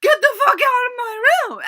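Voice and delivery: high, furious mock scream; very loud, high-pitched outburst. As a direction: shriek it like an outraged drunk woman. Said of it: "screaming at this poor little girl".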